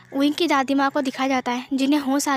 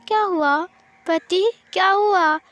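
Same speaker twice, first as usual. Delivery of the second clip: put-on high, slow, whiny child begging; a hurt little kid's plea, slowed right down; childlike pleading; 4 semitones higher, slower than usual. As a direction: tug at him like a small child, drawn out. Wing begs husband to come.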